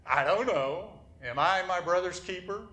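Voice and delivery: high, loud sarcastic comeback. Acted. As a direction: answer with exaggerated, mock-clueless sarcasm. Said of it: "I kind of added that voice inflection".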